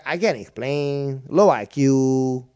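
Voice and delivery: slow, loud mock dimwit; mocking dumb viewer. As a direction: drag it out like a dunce. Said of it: "But he's like".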